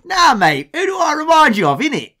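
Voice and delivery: hammed-up London geezer comeback; strong London accent. London manager answering an Oldham fan, exaggerated London accent. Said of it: "he's got a real London accent".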